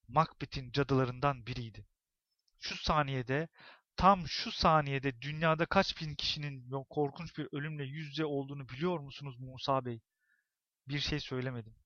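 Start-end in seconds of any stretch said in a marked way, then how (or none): none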